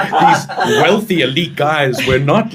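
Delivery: laughing through the words, slowly; amused, laughing slowly. Real, not acted. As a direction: chuckle it out in disbelief. Laughing at school memories.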